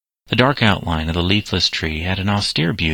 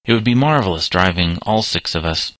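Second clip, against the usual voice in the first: ordinary delivery, nothing marked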